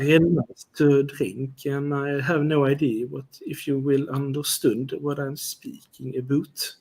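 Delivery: slow, halting Swedish English accent. Shown as a demonstration. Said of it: "it sound like that".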